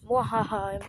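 villain cackle, mwahaha; mock evil laugh, short and hammy; sneering panto villain. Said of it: "I sound like an evil villain".

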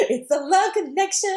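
high, gushing announcement; giddy matchmaking. Retold or imitated. Retelling her thrill at strangers' flirting, giddy. Acts out own excited reaction.

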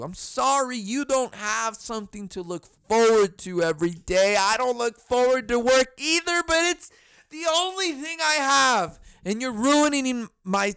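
angry rant. Retold or imitated. Ranting at the yelling neighbor.

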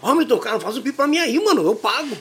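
lightly voiced kid offering to pay; a hint of a boy pleading. (moleque oferecendo pagar, voz leve de garoto)

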